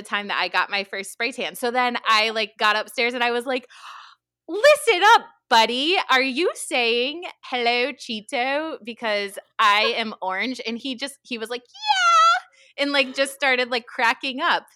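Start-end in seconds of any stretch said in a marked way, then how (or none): 4.5-10.6 loud, high mock scolding, slow at the end; mock stern telling-off. Confronting kid, mock stern. 11.6-12.8 gleeful yes. Retold or imitated. Kid's gleeful yes quoted.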